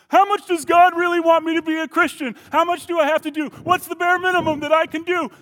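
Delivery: very high, goofy clown voice; Bozo the Clown. Acted. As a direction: play a silly falsetto clown asking questions.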